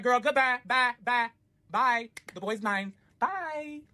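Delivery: high, dismissive singsong bye; sassy goodbye. Retold or imitated. Narrator hanging up on the ex, sassy dismissive bye. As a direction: wave her off smugly.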